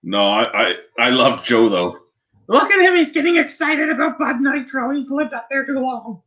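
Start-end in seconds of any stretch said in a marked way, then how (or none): 2.5-6.2 high, hammed-up teasing voice; mock teasing. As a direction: rib your buddy in a silly high voice. Teasing Joe about Bud Nitro.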